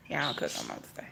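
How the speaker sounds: ordinary talk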